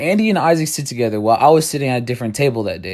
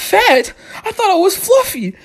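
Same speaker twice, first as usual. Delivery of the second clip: very high, wounded comic squeak; a high, mock-offended squeak. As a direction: play it hurt and prissy. Offended by mom's fat comment.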